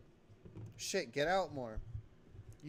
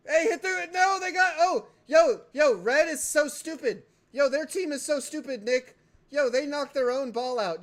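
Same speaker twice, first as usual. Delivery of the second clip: high, loud hype at the screen; excited yelling; 8 semitones higher, 11 dB louder than usual. Real, not acted. Reacting live to the other team's blunder, loud excited yelling. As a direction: shout it out to Nick across the room.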